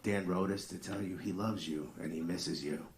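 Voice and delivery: soft, smooth late-night DJ voice; lightly voiced sultry radio host. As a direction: croon it like a love-song DJ. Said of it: "the D J would come on".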